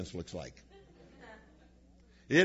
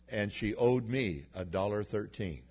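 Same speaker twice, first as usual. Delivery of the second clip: ordinary talk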